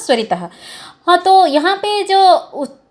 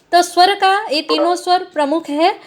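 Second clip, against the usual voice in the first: ordinary talk, nothing marked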